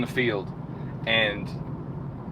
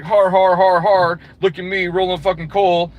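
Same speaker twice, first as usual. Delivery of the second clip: loud, higher braying laugh and boast; mocking diesel douchebag; 3 semitones higher, 4 dB louder than usual. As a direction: ham up a dumb gloating trucker. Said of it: "was like".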